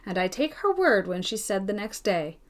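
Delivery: plain reading voice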